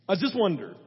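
usual speaking voice

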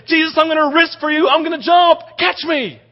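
high, loud hammed-up rooftop yell; mock daredevil shout. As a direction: call out to Jesus like a reckless show-off.

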